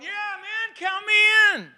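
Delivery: high, loud "count me in" cheer; mock eager shout. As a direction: play an overeager recruit yelling yes. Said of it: "we're not jumping up going".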